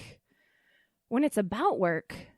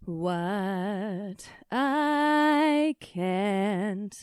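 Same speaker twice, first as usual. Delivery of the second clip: ordinary talk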